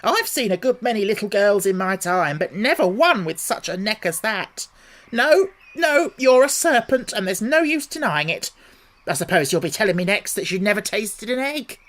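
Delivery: high, loud insistent bird voice; accusing pigeon. A narrator's reading. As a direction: refuse to be fooled.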